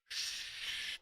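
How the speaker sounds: a whispered shh; shushing. Retold or imitated. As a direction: hush him in a whisper. Hushing friend while hiding.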